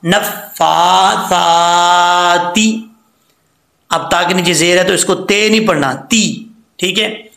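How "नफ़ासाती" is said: very slow drawn-out word; slow recitation. Shown as a demonstration. Teacher recites the joined word very slowly. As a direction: stretch each vowel. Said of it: "सा खड़ा ज़बर सा".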